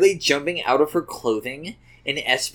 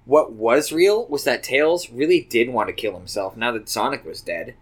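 ordinary delivery, nothing marked

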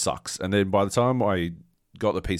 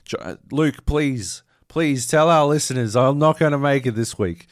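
higher, louder melodramatic plea; mock-tragic higher plea to his co-host; hammed-up dying plea; 8 semitones higher, 8 dB louder than usual. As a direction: beg like a dying hero. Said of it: "And I'm like".

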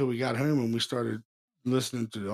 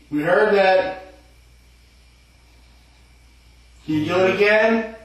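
higher, louder coaxing the ghost; calling to spirit; 7 semitones higher, 6 dB louder than usual. Real, not acted. Ghost hunter asking the spirit to knock, loud and coaxing. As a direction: call out to the spirit.

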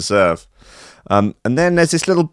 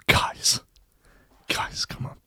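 whisper; 11 dB quieter, slower than usual. Real, not acted. Whispering to get attention.

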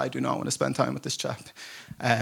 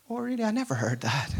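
feigned ignorance; 9 semitones higher, 4 dB quieter than usual. Retold or imitated. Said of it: "pretending the whole time".

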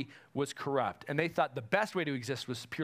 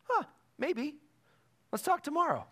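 much higher, airy "maybe, let's talk tomorrow"; noncommittal shrug; 11 semitones higher than usual. Retold or imitated. Preacher imitating a listener who stalls, higher noncommittal voice. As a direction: toss it off like someone stalling a real answer. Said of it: "they go".

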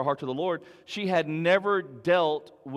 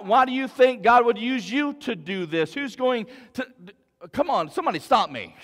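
taunting voice; 4 semitones higher, 5 dB louder than usual. Acted. Preacher voicing the enemy's taunts to the congregation, loud and mocking. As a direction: sneer like a voice in your head. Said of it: "that taunting voice in our head".

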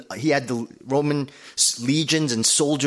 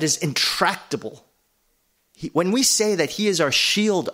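preaching at full force; 5 semitones higher than usual. Acted. Preaching builds to climax.

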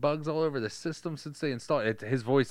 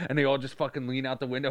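ordinary talk

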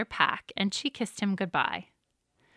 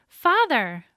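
joyful call; 5 semitones higher, 6 dB louder than usual. A narrator's reading. Daughter greets returning father.

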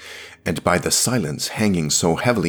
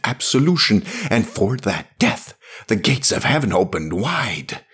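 high, loud, fervent warning; impassioned priest; 12 semitones higher, 5 dB louder than usual. Acted. Priest warns the woman off her hellish journey, impassioned. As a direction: plead and warn at a raised pitch.

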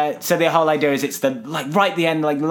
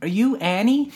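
imitated kid; 7 semitones higher than usual. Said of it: "And then the kid goes".

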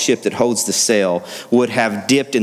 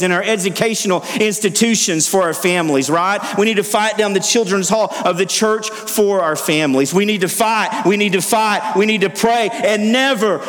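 high, driving preacher's cry; roaring rallying speech; 8 semitones higher than usual. Acted. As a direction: rouse the church to fight. Preaching at full force.

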